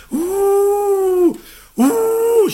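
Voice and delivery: very high, drawn-out imitated howl; wolf howl. Storyteller voices wolves in the polar night, high long howl. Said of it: "lupii urlau înfricoșător".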